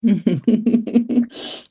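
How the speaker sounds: bursting into a loud laugh; big open laugh. Real, not acted. Laughing at goodbye.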